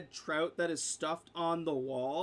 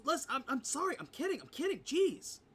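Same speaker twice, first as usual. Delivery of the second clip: higher, a rushed nervous backpedal; panicky apology; 9 semitones higher than usual. Acted. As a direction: talk fast to calm the angry elephant. Elf dodges a thrown chair.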